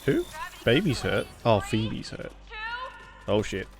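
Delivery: ordinary delivery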